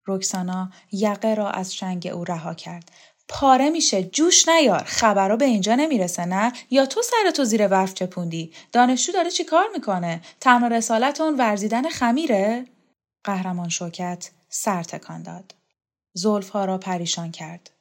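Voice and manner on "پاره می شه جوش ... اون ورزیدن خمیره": higher, needling string of questions; lively acted mockery; taunting woman in a quarrel. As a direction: needle the other with lilting questions.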